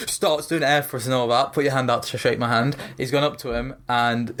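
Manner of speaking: ordinary delivery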